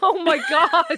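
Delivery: high "oh my God" through laughter; laughing disbelief. Real, not acted. Sister hears the cameo gift idea, laughing disbelief. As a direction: burst out laughing as you say it.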